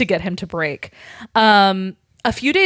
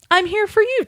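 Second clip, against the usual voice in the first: tender Harry; 12 semitones higher than usual. Retold or imitated.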